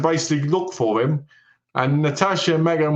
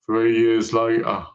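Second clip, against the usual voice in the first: low, slow caption voice; slow, lowered mock-narrator announcement; time-jump caption; 4 semitones lower, slower than usual. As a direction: intone it like a film title card.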